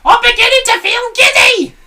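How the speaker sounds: very high, loud panicked screech; Mrs Twit screaming. A narrator's reading. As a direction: shriek for help, dizzy. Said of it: "screamed Missus Twit".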